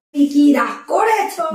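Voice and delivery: very drawn-out teasing question; slow, put-on asking. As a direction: drag the words out in mock concern. Teasing the crying girl with questions.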